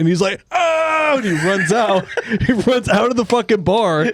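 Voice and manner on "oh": high, long mock wail; comic high scream while fleeing; imitated howl of panic; imitated horrified wail. Injured man flees holding his eye.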